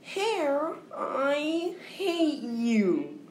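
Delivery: drawn-out high mock anger; high, slow mock hatred. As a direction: stretch out hate in a high voice. Lady shouts at hair.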